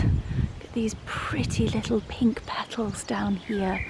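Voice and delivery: hushed, admiring whisper. Real, not acted. Vlogger whispering about the pink petals.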